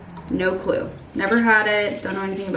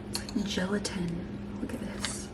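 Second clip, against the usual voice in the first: hushed, breathy aside; whispering; 3 semitones lower, 14 dB quieter than usual. Real, not acted. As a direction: whisper it in awe. Whispering over the sea moss gel.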